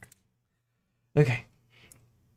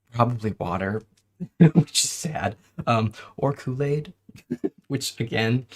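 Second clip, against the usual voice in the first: usual speaking voice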